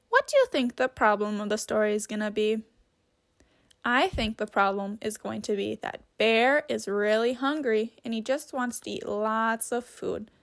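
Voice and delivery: ordinary delivery, nothing marked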